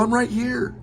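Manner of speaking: put-on high kid voice "I'm right here"; cheeky kid. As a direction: chirp it like a proud punning kid. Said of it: "And I'd go".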